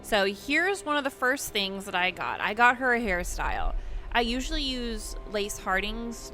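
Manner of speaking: usual speaking voice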